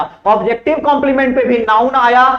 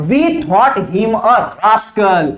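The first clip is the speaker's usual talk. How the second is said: each word said very slowly for the class; slow dictation; much slower than usual. Shown as a demonstration. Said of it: "teacher dictates the sentence".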